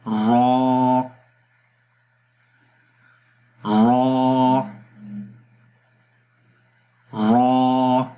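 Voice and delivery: را said thick and full with a rolled r; heavy ra demo. Shown as a demonstration. Teacher models a heavy ra for students. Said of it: "آپ نے میرے ساتھ پڑھنا ہے".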